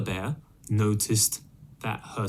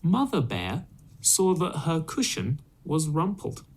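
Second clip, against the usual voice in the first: ordinary delivery, nothing marked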